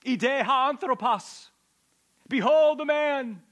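high, loud and slow proclamation; Pilate yelling. Acted. Pilate yells to the crowd, loud and slow. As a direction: call it out to the whole mob. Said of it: "he yells to the crowd".

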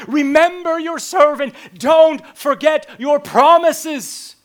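desperate lament shouted upward; crying out to God. Acted. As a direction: wail it up to heaven. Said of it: "It cries out to God".

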